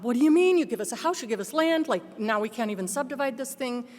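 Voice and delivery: high exasperated pleading; incredulous argument with heaven; arguing with God. Retold or imitated. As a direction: throw the question up to God. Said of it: "arguing with God".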